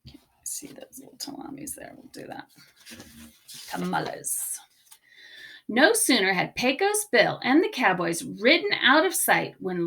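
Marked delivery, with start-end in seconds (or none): 0.1-4.6 soft unvoiced whisper under the breath; whispered aside. Real, not acted. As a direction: whisper it, barely there.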